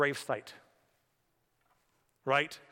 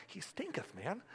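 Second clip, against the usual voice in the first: teasing wife; 3 semitones higher, 13 dB quieter than usual. Retold or imitated. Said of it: "told by your wife".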